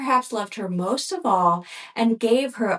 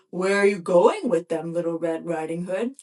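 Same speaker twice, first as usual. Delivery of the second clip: gruff wolf voice; 4 semitones lower than usual. A narrator's reading. Wolf questions the girl.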